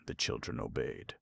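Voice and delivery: usual speaking voice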